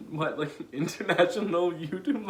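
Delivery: high, tearful disbelief with laughs; real joyful crying, half laughing; crying happy tears. Real, not acted. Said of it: "I can't believe I'm crying".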